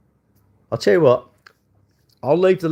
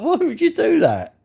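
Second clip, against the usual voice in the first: much higher, slow and disbelieving; incredulous; 3 dB louder than usual. Retold or imitated. Retelling an all-night TikTok watcher, high and incredulous. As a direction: stretch it out in mock disbelief. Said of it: "I thought".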